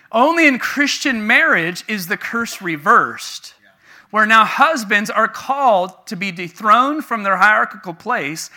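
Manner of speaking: loud, high, emphatic sermon delivery; preaching. Real, not acted. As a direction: preach it out with conviction. Preaches at full force.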